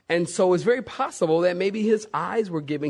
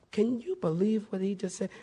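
hushed, higher gossipy aside; mock gossip; 4 semitones higher, 7 dB quieter than usual. Paul nudging the Pharisees, in a hushed gossipy aside. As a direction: egg them on under your breath. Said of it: "look over at the Pharisees and go".

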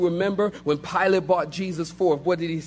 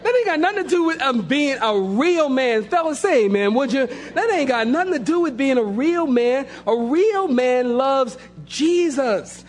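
preaching; 5 semitones higher than usual. Acted. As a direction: build the call to real men. Preaching at full force.

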